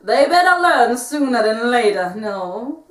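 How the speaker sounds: put-on Puss in Boots style Spanish accent. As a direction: heroic swashbuckler in a Spanish accent. Held Puss character voice.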